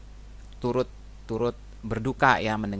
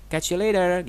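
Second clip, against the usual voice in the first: lively casual goodbye; 9 semitones higher, 7 dB louder than usual. Shown as a demonstration. Teacher acting out casual goodbye.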